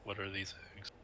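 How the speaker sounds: hushed, very soft question; soft secretive aside; whisper to Maxine. Acted. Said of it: "just whisper to Maxine".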